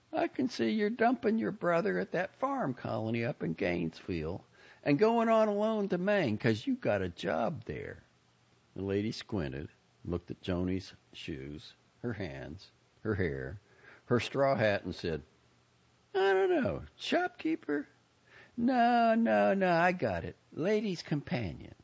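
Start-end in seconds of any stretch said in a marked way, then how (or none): 0.1-8.0 high-pitched folksy lady reading strangers; knowing Southern busybody. Acted. Lady tells Joni what she perceives, knowing. 16.1-21.7 high lady voice, landing on an answer; guessing busybody. Acted. Lady guesses Joni's job from her looks, eager.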